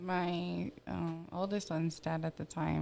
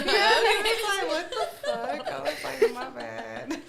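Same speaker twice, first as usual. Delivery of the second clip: loud, high real laughter; cracking up; 10 semitones higher, 8 dB louder than usual. Real, not acted. Storyteller retelling boyfriend's reaction, cracking up. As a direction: get the words out while laughing hard.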